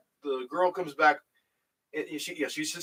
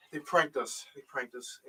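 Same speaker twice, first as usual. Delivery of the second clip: stunned quiet; 4 semitones lower, 9 dB quieter than usual. Retold or imitated. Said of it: "It's like".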